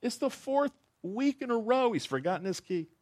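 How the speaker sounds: high, exasperated punchline; exasperated man. Acted. Dog's owner answering Mrs Goldstein, exasperated high voice. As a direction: deliver the weary owner's excuse. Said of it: "He says".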